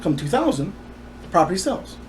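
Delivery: usual speaking voice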